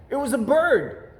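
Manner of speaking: cub voice. Acted. Cub starts a lie.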